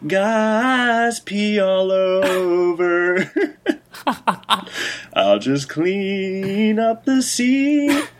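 high, slow elf singer talking. Acted. Elf singer's spoken aside.

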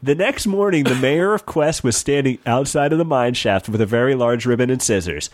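ordinary delivery

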